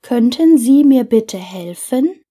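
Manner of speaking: ordinary talk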